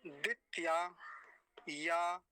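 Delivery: वरुणाय very slowly, syllable by syllable; slow part demo. Shown as a demonstration. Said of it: "बस इतना बोलेंगे सबसे पहले". (वरुणाय धीरे धीरे)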